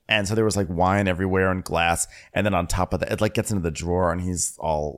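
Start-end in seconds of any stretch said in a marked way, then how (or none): none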